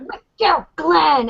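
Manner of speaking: high-pitched list of names in protest; mock outraged cry. Fan protests Glenn being left off posters, mock outrage. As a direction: punch each name high, as if objecting.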